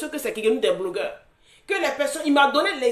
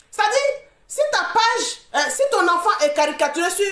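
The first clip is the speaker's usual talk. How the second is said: heated outburst; 6 semitones higher, 3 dB louder than usual. Real, not acted. Heated argument, pitch jumps.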